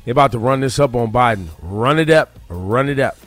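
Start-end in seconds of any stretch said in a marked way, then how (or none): none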